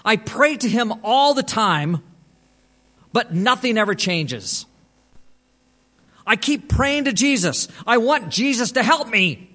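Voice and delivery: imitated despairing question; anguished pleading. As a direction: plead it like a man at a loss. Retelling the man's anguished complaint.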